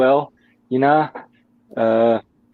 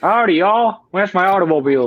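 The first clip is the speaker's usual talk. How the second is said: higher-pitched put-on Southern American accent; mock American accent; 4 semitones higher than usual. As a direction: copy a cartoonish American twang, pitched up. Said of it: "do an American accent then".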